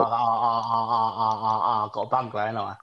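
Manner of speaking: put-on Indian accent with chanted "ah"s; mock Indian accent. Imagining bungalow said in India, mock Indian accent. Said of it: "replanned in my head in an Indian accent".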